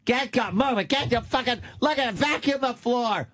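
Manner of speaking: high, barked mock orders; mock raging mother. Mother yelling chores at the kids, high mock rant. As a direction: bark chores like a crazed mom. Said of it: "she lost her fucking mind on Sundays".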